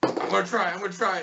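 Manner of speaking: high little gnome voice; struggling gnome. Acted. Gnome pinned by the rat answers, high struggling voice. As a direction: squeak it out as if straining. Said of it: "he's still just struggling".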